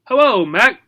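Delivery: high smiling gerbil voice; bright happy greeting; cheerful gerbil. Acted. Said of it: "with a big, cheerful smile".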